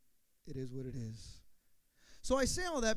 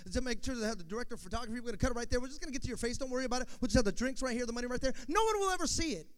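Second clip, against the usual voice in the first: rapid, louder patter rising higher at the end; fast talker; 4 semitones higher, 5 dB louder, much faster than usual. Retold or imitated. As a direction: rush to close the deal. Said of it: "this guy was a fast talker".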